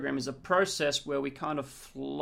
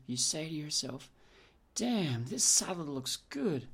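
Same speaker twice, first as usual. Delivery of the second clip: guided visualisation; 3 dB quieter than usual. Acted. Guiding a future pace.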